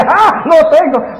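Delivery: a high, loud triumphant cry; gloating Satan. Acted. Preacher voicing Satan gloating over his chess move. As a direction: crow it like you just trapped the king.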